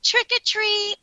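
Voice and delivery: put-on high, singsong little girl voice; imitating the little girl. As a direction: mimic the girl's sweet trick-or-treat call. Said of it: "she says".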